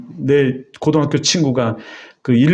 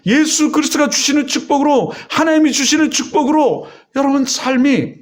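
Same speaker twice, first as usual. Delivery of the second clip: much higher, urgent preaching to the congregation; fervent preaching; 8 semitones higher than usual. Acted. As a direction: drive the blessing home like a revival preacher. Preaching at full force.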